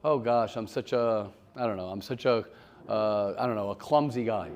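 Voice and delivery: low, understated self-putdown; mock self-talk. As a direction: imitate someone quietly running themselves down. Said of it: "they'll repeat in their brain over and over".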